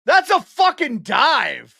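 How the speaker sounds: loud, furious yell at the dive, slowed down; angry shout. Real, not acted. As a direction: bellow it at the refs in disbelief. Streamer raging at a penalty call.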